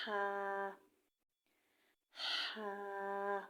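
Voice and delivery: ہا pushed hard from the throat; throaty ha. Shown as a demonstration. Teacher demonstrating a throat sound. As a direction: breathe ہا out from the throat. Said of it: "گلے سے سختی سے آواز نکالنی چاہیے".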